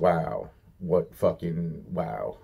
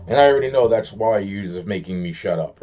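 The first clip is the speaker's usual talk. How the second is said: usual speaking voice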